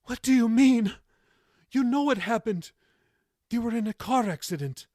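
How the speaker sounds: high, tearful, astonished pleading; through tears. A narrator's reading. Grandmother crying as grandson confronts her, tearful. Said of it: "Through tears".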